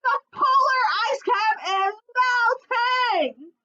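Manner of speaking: very high, loud, slow shout. Acted. Fox shouting the news to the polar bear queen. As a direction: bellow the alarm. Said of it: "shouted Foxy Loxy".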